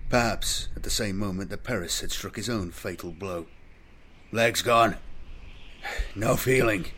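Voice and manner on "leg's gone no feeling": halting, strained croak of the wounded; pained croak. A narrator's reading. Wounded sergeant reporting to the apothecary, croaking. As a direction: croak it out in short bursts. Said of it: "Paris croaked".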